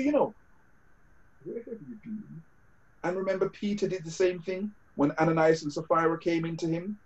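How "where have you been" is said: near whisper. Retold or imitated. Question repeated almost inaudibly.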